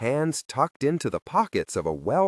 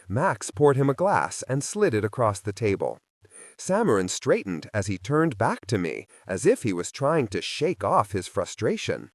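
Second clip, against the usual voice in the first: plain reading voice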